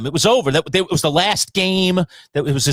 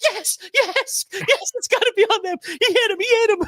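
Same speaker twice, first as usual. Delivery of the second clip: high, giddy pleading with a laugh; excited pleading. Retold or imitated. Pleading with the refs on TV during the game. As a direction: beg the refs, half laughing. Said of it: "I'm like".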